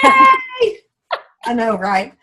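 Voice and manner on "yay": high, loud squeal of joy; excited cheer. Real, not acted. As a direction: cheer it out at the top of your pitch. Cheering after listener's joke.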